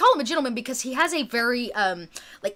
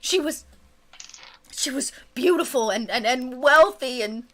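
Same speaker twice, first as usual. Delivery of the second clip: higher, stammering, breaking into a whisper; blubbering; 4 semitones higher than usual. Acted. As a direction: sob and stutter helplessly. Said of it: "he keeps kind of weeping and crying".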